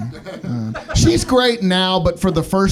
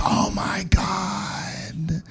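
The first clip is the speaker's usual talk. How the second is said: very slow, drawn-out whisper; desperate whisper; 5 dB quieter, slower than usual. Acted. Parent whispering as baby still cries, desperate whisper. Weary parent, a week later.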